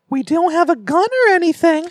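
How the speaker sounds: put-on high eerie little kid voice; innocent-sounding but sinister; creepy child voice. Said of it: "that voice".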